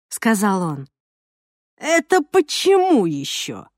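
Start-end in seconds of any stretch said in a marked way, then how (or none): 1.8-3.7 slow, sneering through gritted teeth. Acted. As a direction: spit it out slowly, contemptuous. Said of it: "процедил Жох".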